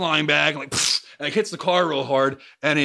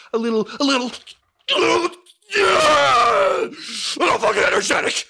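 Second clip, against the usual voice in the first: climbs higher and louder into a yelling shout; building to a shout; 9 semitones higher, 4 dB louder than usual. Shown as a demonstration. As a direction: show them what energetic means, bursting out. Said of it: "sometimes I can be a little, a little energetic".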